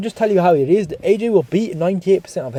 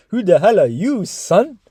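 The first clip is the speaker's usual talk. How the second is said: mimicking an indignant put-down; mock challenge; 4 semitones higher, slower than usual. YouTuber echoing clip at the trainer, mock challenge. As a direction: throw the clip's challenge back, pitch lifting.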